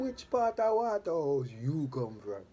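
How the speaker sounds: porter pressing him, a light Jamaican lilt; Jamaican accent. Retold or imitated. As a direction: imitate a Jamaican asking where exactly. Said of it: "put on my Jamaican accent".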